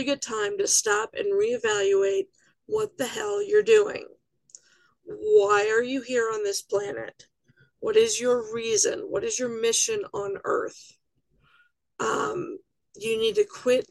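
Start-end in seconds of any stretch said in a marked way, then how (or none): none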